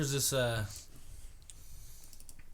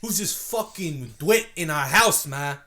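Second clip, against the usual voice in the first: louder slow Boston growl; slow loud Boston accent; Boston tough guy; 3 semitones higher, 6 dB louder, slower than usual. Retold or imitated. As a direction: demand it like an angry Boston son. Boston bit.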